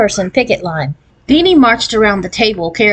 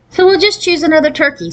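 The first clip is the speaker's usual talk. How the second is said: matter-of-fact, higher voice; practical; 9 semitones higher than usual. A narrator's reading. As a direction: sensible suggestion. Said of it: "said Party practically".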